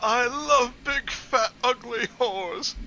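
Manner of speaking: high, slow put-on creep; hammed-up creep voice. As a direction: drag it out like a cartoon creep. Mock character line, voice put on.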